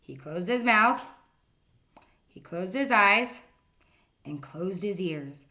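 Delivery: ordinary delivery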